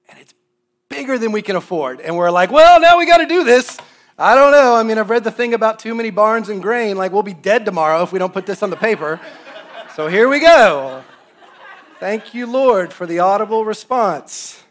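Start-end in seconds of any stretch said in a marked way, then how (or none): 2.5-9.2 high, very loud hammed-up alarm; mock panic. Couple facing a number they can't afford, loud mock panic. 10.0-14.6 loud, high, drawn-out comic resignation; mock brave surrender. Couple thanking God while super scared, loud mock surrender.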